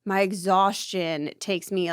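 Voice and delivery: ordinary talk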